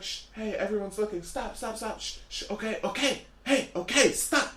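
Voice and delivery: soft, hushed shushing; hushing mother. Retold or imitated. Mother hushing her crying son in the supermarket, soft and whispered. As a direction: shush the child quietly in the store. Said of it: "She's like".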